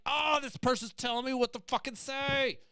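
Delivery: high, huffy inner grumble; indignant inner voice. Retold or imitated. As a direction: sound offended at being told off. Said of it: "what came over me was like".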